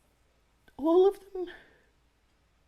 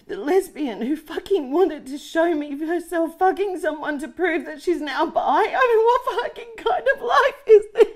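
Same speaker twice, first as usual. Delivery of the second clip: louder and higher, cracking into laughter; hysterical laughing rant; 4 semitones higher, 7 dB louder than usual. Acted. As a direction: let it spill over into manic laughter. Breakdown rant.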